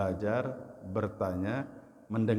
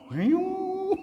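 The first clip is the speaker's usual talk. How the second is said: very high mock bawl; imitated crying wail; 3 dB louder than usual. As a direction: wail like someone bursting into tears. (tiruan raungan tangis melengking)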